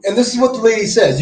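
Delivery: normal talking voice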